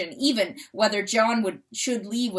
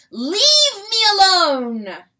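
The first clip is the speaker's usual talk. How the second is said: very high, very loud, slow; crowing shout; 10 semitones higher, 11 dB louder, slower than usual. Acted. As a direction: drive him off with a crow. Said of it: "the rooster crowed".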